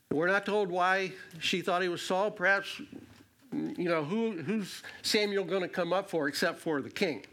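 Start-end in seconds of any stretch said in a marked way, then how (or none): none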